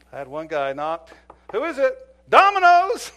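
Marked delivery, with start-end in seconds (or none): 1.5-3.1 loud, much higher cheerful call; fake delivery shout. Officer faking a delivery at a suspect's door, loud and bright. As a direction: sell the pizza ruse.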